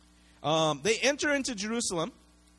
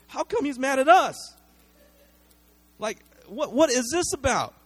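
high, loud, baffled outcry of the crowd; a crowd's loud, bewildered protest; indignant crowd; 7 semitones higher, 6 dB louder than usual. Retold or imitated. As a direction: sound shocked that he's angry at you. Crowd voiced, confused and upset.

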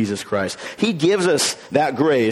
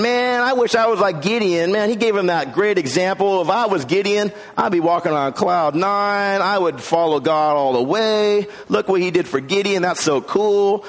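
wishful listener; 6 semitones higher than usual. Retold or imitated. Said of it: "If you're thinking".

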